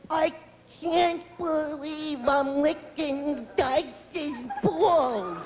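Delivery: slow, breathy, giggly mock groupie; awestruck groupie. Groupie gushing at her mirror, slow and giggly. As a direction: drag it out like a starstruck fan. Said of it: "the chick's looking in the mirror going".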